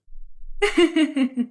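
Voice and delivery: a falling, teasing cackle; mocking laugh. Neighbour jeering at the daughters-in-law, falling laugh. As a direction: laugh down at them.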